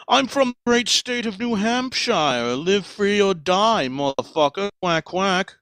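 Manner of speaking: proud patriotic duck bragging; Mister Duckington. Acted. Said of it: "What state is Mister Duckington from?".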